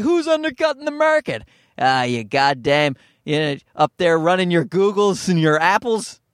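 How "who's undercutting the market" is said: shrill mock tycoon, far higher; outraged big-shot, played up high; mock corporate exec. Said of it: "gonna be like".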